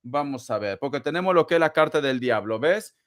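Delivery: ordinary talk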